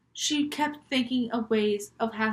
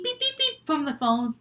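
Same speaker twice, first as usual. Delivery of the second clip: ordinary delivery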